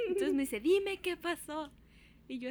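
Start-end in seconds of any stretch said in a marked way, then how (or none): 0.6-1.7 imitated mother, high and stretched out; mock parental interrogation; demanding mother. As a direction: demand it slowly, pitched up, like mom.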